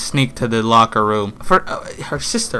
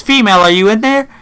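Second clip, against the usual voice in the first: mother calling; 9 semitones higher, 4 dB louder than usual. Retold or imitated. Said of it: "she says".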